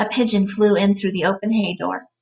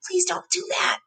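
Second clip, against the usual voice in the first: whispered frightened plea; 11 semitones higher, 4 dB quieter than usual. A narrator's reading. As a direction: breathe it out in terror. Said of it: "Lenny began to cry with fright".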